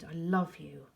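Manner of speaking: ordinary delivery, nothing marked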